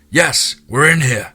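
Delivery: slow, high, loud answering call; yelled reply. A narrator's reading. Jake answers the soldiers outside the door, yelled back. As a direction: yell back so they hear you. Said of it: "Jake yelled back".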